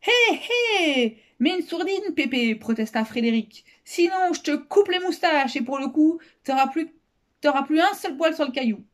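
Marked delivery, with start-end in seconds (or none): 0.1-2.6 put-on high, cocky boy's jeer; high and sing-out, full of cheek; cheeky protest. A narrator's reading. Boy heckles adults, very high. 3.8-8.8 cheeky threat. A narrator's reading. Boy threatens in acted quote.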